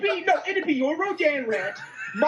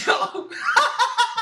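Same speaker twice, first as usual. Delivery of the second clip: burst of laughter; 7 semitones higher than usual. Real, not acted. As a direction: crack up at the rant. Co-host reacts to rant.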